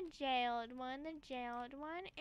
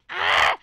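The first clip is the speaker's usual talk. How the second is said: loud, high yelp; sudden loud "ah"; short scream; 4 semitones higher, 18 dB louder than usual. Pretend. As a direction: let out a quick sharp yell. Scream while playing.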